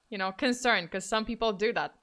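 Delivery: ordinary talk, nothing marked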